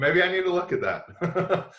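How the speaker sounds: slow, high and laughing through it; laughing admission. Real, not acted. Admitting to his son's complaint, laughing at himself. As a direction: chuckle while conceding.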